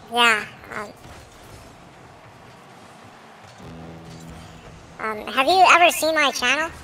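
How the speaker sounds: sped-up, squeaky voice changer; chipmunk voice. Real, not acted. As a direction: talk through a chipmunk filter. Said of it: "the middle guy is using the chipmunk voice".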